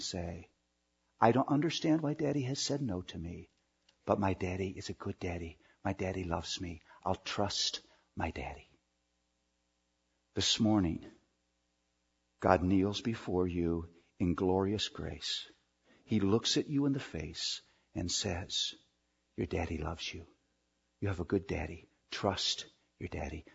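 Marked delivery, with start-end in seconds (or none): 1.2-8.7 soft, quick, lower self-talk; lightly voiced child reassuring himself. Child talks to self in hallway. 19.4-23.4 much softer and lower, tender and sure; God speaking softly face to face; tender reassurance. Acted.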